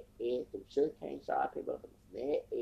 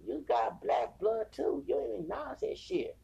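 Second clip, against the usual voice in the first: pitched-up exasperated comeback; mock indignant retort; 11 semitones higher, 3 dB louder than usual. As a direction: throw it back at them in a higher voice. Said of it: "I'm like".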